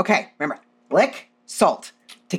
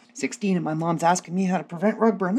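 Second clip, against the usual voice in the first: ordinary delivery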